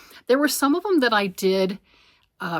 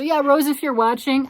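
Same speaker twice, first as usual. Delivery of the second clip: calling out; 6 semitones higher, 7 dB louder than usual. Real, not acted. Calling out to a viewer.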